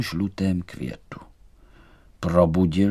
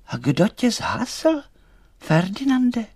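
very high, slow little doll voice; high-pitched doll's question; concerned doll; 13 semitones higher, slower than usual. A narrator's reading. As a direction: ask slowly and high, with concern. Doll asks the little light.

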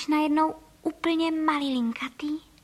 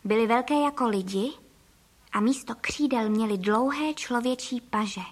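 ordinary delivery, nothing marked

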